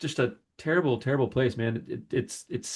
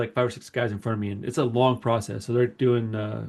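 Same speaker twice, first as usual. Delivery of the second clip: usual speaking voice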